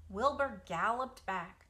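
ordinary delivery, nothing marked

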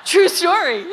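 a real laugh on true story. Real, not acted. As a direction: insist through real laughter. Laughing confirmation of nun story.

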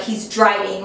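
ordinary talk

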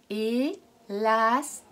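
Élastique cut into slow syllables; syllable-count Élastique slowly. Shown as a demonstration. Teacher splits Élastique into syllables for pupils. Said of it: "On le redit?".

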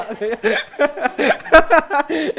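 loud, high laugh at her own joke; a long loud laugh that takes over; real burst of laughter. Real, not acted. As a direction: break up laughing.

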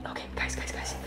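hushed aside to the viewers; very soft, unvoiced aside; whispered aside. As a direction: whisper so the dog won't hear. Aside to viewers near dog.

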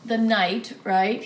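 usual speaking voice

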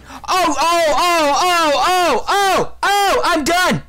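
high, repeated sobbing moans; high-pitched gamer meltdown; anguished wailing. Real, not acted. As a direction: wail each "oh" in despair at losing. Wailing after losing the game.

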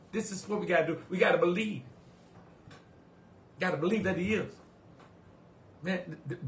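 ordinary talk

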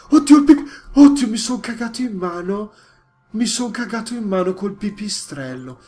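loud high panicked scream, easing off; terrified yell. Real, not acted. Gamer startled by a bat, screaming in panic. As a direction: scream in real fright, then calm a little. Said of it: "Non devo urlare".

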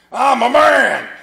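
mock macho shout; burly man. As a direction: puff up like a tough guy. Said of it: "big burly men".

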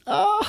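high-pitched "oh" into laughter; delighted falsetto cackle; giddy laugh. Pretend. Food fan thrilled by cheddar, giddy high laugh.

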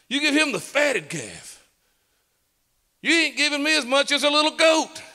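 put-on aggrieved older son. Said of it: "the eldest son says".

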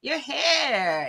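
imitating Zoom colleagues remarking on her hair; mock gasp at hair. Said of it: "people are like".

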